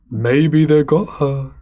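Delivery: slow, emphatic repetition; drawn-out repeat. Acted. Ryan repeating his guess about the kids, slow and emphatic. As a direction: stretch each word out for effect.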